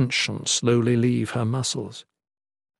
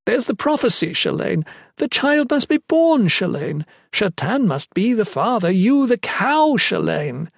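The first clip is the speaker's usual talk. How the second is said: high singled-out phrases, dropping on the name; mocking imitation; 6 semitones higher than usual. A narrator's reading. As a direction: mimic their nagging with bitter scorn. Mockingly voices others lecturing her.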